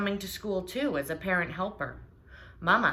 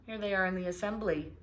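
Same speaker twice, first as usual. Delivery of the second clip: plain reading voice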